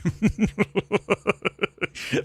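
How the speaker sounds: high staccato chuckle; long run of quick, high laughs. Real, not acted. Podcaster cracks up at Rhythm Nation walkouts, high staccato laugh.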